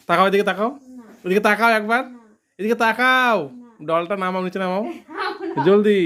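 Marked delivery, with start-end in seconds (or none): 4.8-5.6 giggling refusal; laughing. Real, not acted. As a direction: laugh through the no. Teasing refusal while laughing. (হাসতে হাসতে)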